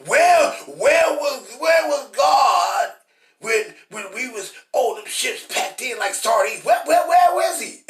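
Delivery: high, loud, whining where-was-God cry; mock complainer's shout. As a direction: shout it like a griping accuser. Voicing complainers' accusing cry.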